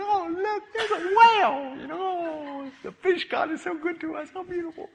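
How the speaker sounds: very high, dreamy sightseer voice; hammed-up falsetto admiration; awed couple. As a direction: coo in mock wonder at the sight. Couple admiring a whale at sunset.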